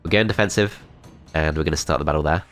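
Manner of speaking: ordinary delivery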